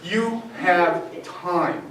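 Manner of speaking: calming. Shown as a demonstration. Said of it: "to tell you to calm down".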